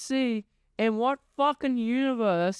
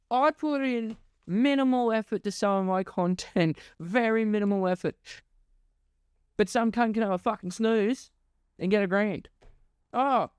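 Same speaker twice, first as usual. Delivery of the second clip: ordinary delivery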